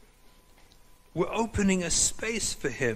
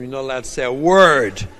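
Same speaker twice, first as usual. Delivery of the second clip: loud, firm order to be silent; stern command; 10 dB louder than usual. Retold or imitated. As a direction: shut her down hard, not a word.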